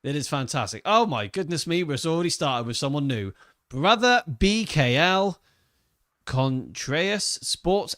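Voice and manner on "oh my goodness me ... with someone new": ordinary talk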